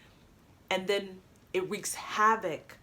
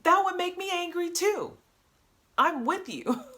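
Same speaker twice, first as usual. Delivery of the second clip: indignant support; 11 semitones higher, 3 dB louder than usual. Shown as a demonstration. A coach demonstrates backing up an angry friend, with eager indignation. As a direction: pitch leaps high in outraged solidarity. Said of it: "Feel free to use my facial expressions".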